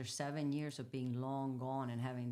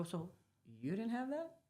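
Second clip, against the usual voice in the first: surprised question; 6 semitones higher, 4 dB louder than usual. Retold or imitated. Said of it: "and you go".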